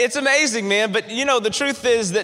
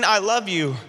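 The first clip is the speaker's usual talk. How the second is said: ordinary delivery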